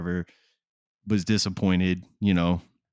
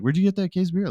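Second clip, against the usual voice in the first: questioning coworker; 11 semitones higher, faster than usual. Retold or imitated.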